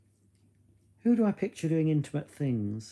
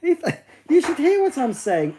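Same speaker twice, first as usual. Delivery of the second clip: loud teasing retort; 12 semitones higher, 7 dB louder than usual. Real, not acted.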